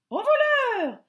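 very high, loud alarm call; clattering stork. A narrator's reading. Stork catching the bear at her nest, very high loud cry. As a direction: shriek thief like a stork. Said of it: "claqueta un énorme oiseau".

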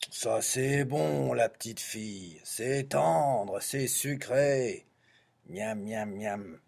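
slow, drawn-out savouring; greedy relish. Acted. Wolf craving the little girl, slow greedy relish. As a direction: linger on each word as if tasting it.

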